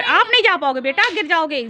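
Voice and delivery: loud, high-pitched parental warning. Real, not acted. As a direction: warn the little one off climbing. Warning crying child against climbing.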